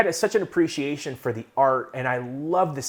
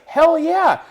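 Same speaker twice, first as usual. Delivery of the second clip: high, loud enthusiastic "hell yeah"; eager yes; 8 dB louder than usual. Retold or imitated. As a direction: grab the offer excitedly. The guy accepts eagerly.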